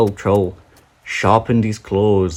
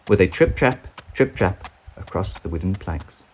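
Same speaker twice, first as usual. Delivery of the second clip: plain reading voice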